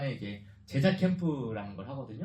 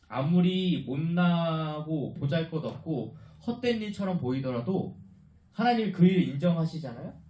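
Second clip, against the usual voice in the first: preaching emphasis; 3 semitones higher, 6 dB louder than usual. Real, not acted.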